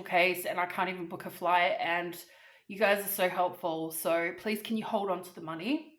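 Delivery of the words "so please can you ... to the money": ordinary talk, nothing marked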